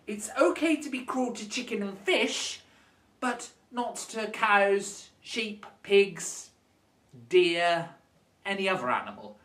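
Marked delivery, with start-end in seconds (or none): none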